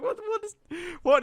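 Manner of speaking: baffled. Retold or imitated. Retold confusion at changed voice.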